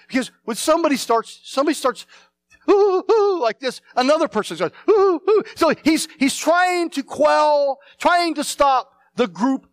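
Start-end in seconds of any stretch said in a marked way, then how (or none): 2.7-3.4 high, loud mock whimpers of fear; panicky ooh ooh. Preacher mimics a frightened crowd member panicking. 4.9-5.4 high, loud mock whimpers of fear; panicky ooh ooh. Preacher mimics a frightened crowd member panicking. Another person joins the panic.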